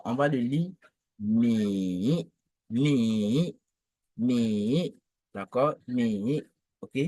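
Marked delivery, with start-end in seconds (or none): 1.2-6.4 mi low, dipping and rising; third tone. Shown as a demonstration. Teacher drilling third tone mi for students. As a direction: hold mi down low, then lift. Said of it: "mi, donc au troisième ton".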